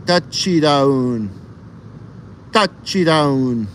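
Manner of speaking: Tachidown slow, syllable by syllable, ta-chi-down; Japanese pronunciation. Shown as a demonstration. Trying the Japanese touchdown, slow and careful. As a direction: sound out Tachidown slowly like a learner. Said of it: "Japanese pronunciation of touchdown".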